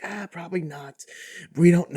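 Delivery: high, breathy-free mock suit voice, unsure shrug; doubtful studio exec. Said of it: "some men in suits out there, but that are like".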